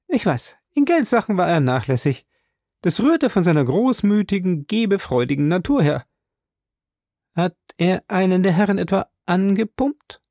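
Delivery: high, a touch resigned; Mrs Baker. A narrator's reading. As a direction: admit his faults, then ask warily.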